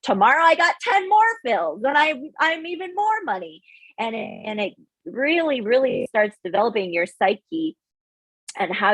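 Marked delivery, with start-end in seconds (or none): 0.0-3.6 high, excited bragging; mock-naive glee; giddy boast. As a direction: crow about easy money, pitched way up. Voicing naive younger self boasting.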